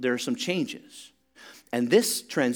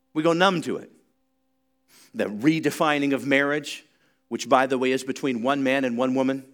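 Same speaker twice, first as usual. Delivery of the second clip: fiery preaching; 2 semitones higher, 4 dB louder than usual. Acted. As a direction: preach it hard, building to a cry.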